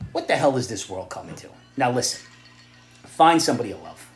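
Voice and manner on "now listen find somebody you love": usual speaking voice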